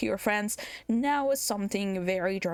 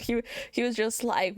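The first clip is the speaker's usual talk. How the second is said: usual speaking voice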